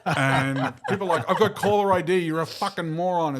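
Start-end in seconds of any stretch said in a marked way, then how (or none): 1.3-3.4 raised, louder phone outburst; mock irate friend. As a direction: imitate an exasperated friend woken by calls. Acted angry friend on phone.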